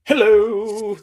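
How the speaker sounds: ordinary talk, nothing marked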